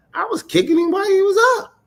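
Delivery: fast, high-pitched squawk of protest; indignant comeback. Retold or imitated. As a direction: protest it way up high, words tumbling out.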